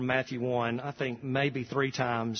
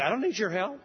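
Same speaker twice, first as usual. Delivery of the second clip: defensive; 7 semitones higher, faster than usual. Retold or imitated. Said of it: "you get defensive and say".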